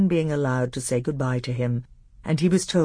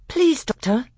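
high, louder pleading pupil voice; young pupil; 11 semitones higher, 6 dB louder than usual. A narrator's reading. Caspian asking his tutor a question, eager and high. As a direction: pipe up politely as an eager young prince.

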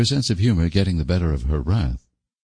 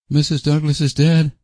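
worn-out and pitched up; weary; 9 semitones higher than usual. A narrator's reading. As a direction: break the sad news tiredly. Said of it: "she said wearily".

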